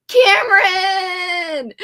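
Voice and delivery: loud, high, slow drawn-out call of the name; delighted drawn-out shout to a viewer; excited greeting. Real, not acted. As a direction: call Cameron out like spotting a friend.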